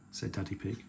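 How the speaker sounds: usual speaking voice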